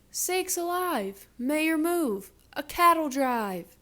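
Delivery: high, loud firemen's yell; mock scream. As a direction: yell a warning to the mayor. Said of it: "The firemen screamed".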